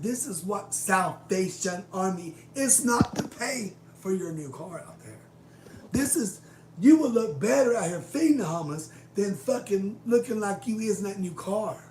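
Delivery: loud, high, furious tirade; going off on her. Retold or imitated. Telling off the store owner about the homeless, furious and loud. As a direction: let her have it, full blast. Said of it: "this is when I went off on her".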